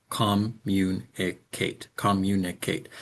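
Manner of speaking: "communicate" with every syllable equally stressed, slowed; computer-style equal stress. Shown as a demonstration. Teacher mimicking how eighties computers spoke, equal stress on every syllable. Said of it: "that's how computers spoke".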